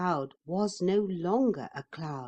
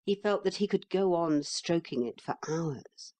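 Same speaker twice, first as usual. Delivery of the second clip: ordinary delivery, nothing marked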